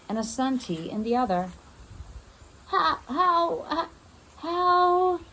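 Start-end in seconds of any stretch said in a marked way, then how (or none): 2.7-5.2 high, shocked stammer; stuttering grandma. Acted. Grandma sees Vanessa, stammers in high shock. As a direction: stumble over the word in fear. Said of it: "Grandma stuttered".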